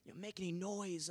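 hushed and low, as if hiding from the government; hushed underground voice. Shown as a demonstration. Pastor mimicking a persecuted church in hiding, hushed. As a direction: keep it hushed so nobody hears. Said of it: "we're having to talk like this".

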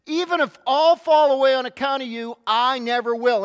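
loud, boastful promise of loyalty; prideful self-confidence. Acted. Peter boasting to Jesus he'll never fall away, loud and proud. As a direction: puff up and vow it proudly to the crowd. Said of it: "said in his prideful self-confidence".

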